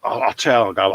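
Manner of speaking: usual speaking voice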